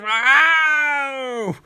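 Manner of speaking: hammed-up villain's falling yell; mock cry of defeat. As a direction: wail it as the villain goes down, in jest. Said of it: "He's thrown and he dies".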